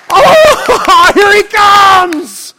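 high, loud, laughing cry of joy; excited trees. Acted. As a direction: burst out laughing with delight, very high. Trees voiced greeting the King.

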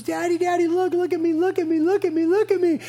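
put-on high, eager little-girl voice calling daddy; imitating his small daughter begging daddy to look; excited little daughter. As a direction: bounce with excitement like a four-year-old. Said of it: "she was just so excited".

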